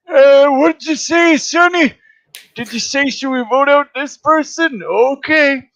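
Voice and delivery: put-on high, slow, quavering old geezer voice; doddering old man. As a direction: play a deaf old man asking his grandson to repeat. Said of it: "we'll be the two oldest people. We'll go".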